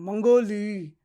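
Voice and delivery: মঙ্গোলি said loud and clear, falling at the end; pronunciation demo. Shown as a demonstration. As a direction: show students how মঙ্গোলি sounds. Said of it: "মানে উচ্চারণটা এরকম". (উচ্চারণ দেখানো মঙ্গোলি)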